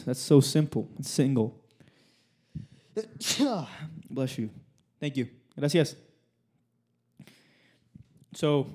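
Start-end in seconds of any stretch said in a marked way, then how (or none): 2.9-4.0 a sudden real sneeze; sneezing. Real, not acted. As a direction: sneeze sharply mid-talk. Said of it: "I just sneezed".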